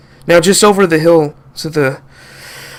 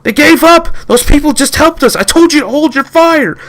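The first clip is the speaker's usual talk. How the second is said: high, loud berating; angry yelling; 12 semitones higher, 5 dB louder than usual. Acted. Captain berating the soldier who fired: high angry shouting. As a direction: yell at him for disobeying.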